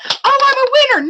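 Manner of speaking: high bratty mock gloat; mocking Bethany. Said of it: "it was like".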